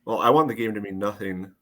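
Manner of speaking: ordinary talk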